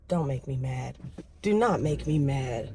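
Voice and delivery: quiet, low-pitched warning. Real, not acted. Vlogger warning someone not to make her mad, low warning. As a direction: warn under your breath, dropped low and soft. Muttered warning while filming.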